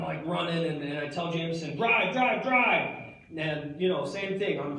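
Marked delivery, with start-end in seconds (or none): 1.8-3.0 raised, urgent yell to get going; higher and louder, hurry him up; urgent shout. Retold or imitated. Shouting at Jameson from the truck to drive, urgent. Urging Jameson to flee cop.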